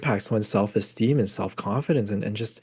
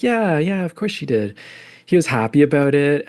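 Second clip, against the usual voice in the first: put-on higher, breezy kid voice; imitating her son; 4 semitones higher than usual. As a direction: lightly play the son brushing it off. Mother retells son's answer.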